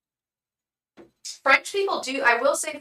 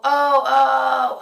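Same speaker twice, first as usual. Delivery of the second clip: startled oh; 4 semitones higher, 11 dB louder than usual. Real, not acted. Sudden interruption mid-reading.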